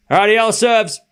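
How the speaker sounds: ordinary talk, nothing marked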